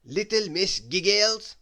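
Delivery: slow, loud, self-important call; pompous manager calling her name; importantly. A narrator's reading. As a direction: summon her like a pompous boss. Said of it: "said the manager importantly".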